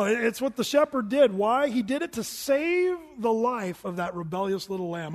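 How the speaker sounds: ordinary talk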